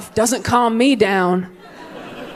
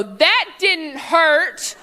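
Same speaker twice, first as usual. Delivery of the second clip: higher, very slow taunt; defiant kid; 7 semitones higher, much slower than usual. Retold or imitated. Kid taunting mom mid-spanking, slow taunt. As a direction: drag it out to provoke. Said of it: "I go".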